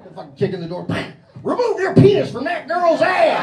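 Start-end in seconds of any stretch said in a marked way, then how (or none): 1.4-3.4 shouted cop order. Pretend. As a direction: scream the order through the door. Cops yelling at suspect.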